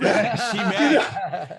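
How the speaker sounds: laughing high and slow. Real, not acted. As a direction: laugh through it. Laughing agreement.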